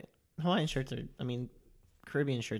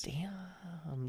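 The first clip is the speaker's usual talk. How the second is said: awed whisper; whispered damn; 5 semitones higher, 8 dB quieter than usual. Real, not acted. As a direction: breathe it out, impressed. Impressed by the figure.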